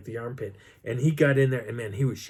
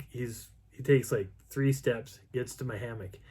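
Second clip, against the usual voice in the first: usual speaking voice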